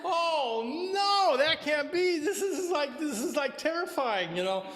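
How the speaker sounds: a disciple frozen in horror, shrieking it out high; horrified. Acted. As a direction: Ananda overhearing outside, in horrified high panic. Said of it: "He's just horrified when he hears this".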